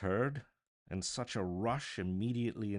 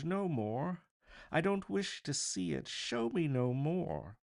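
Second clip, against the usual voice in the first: higher, louder, anguished begging; desperate pleading; 7 semitones higher, 4 dB louder than usual. Acted. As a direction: beg the spirit to stop. Scrooge begging the ghost to stop.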